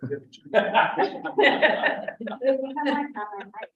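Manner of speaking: short self-conscious laugh; embarrassed laugh. Real, not acted. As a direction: laugh off the awkward moment. Embarrassed at her own voice.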